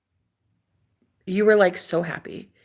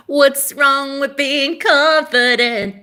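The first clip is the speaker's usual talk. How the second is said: sassy drawl; 7 semitones higher, 6 dB louder, much slower than usual. Acted. Sassy catchphrase, drawn out.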